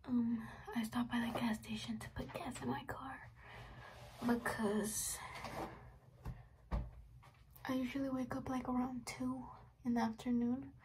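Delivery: soft unvoiced whisper. Real, not acted. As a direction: whisper so nobody wakes up. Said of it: "I'm talking like this because everybody's asleep already".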